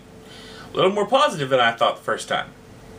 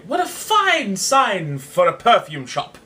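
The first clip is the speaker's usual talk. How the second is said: higher, slow gushing praise; mock admiring; 7 semitones higher, 5 dB louder, slower than usual. Cyrano gushing at the heckler, slow and mock-admiring.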